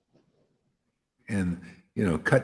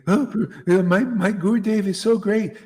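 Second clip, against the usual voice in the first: high, gushing parody with a laugh; mocking gushing devotee; 9 semitones higher, 5 dB louder than usual. Preacher mimicking a follower praising his guru, high and gushing. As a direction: ham up a starry-eyed follower.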